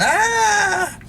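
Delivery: a sudden high yelp, mimicking the brother; imitated scream. Narrator mimics little brother's fake scream, sharp high yelp. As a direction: shriek like a kid getting the belt. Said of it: "right, screaming and yelling".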